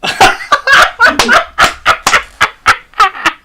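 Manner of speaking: high staccato laugh. Real, not acted. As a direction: burst of breathy cackling in quick pulses. Laughing after singing.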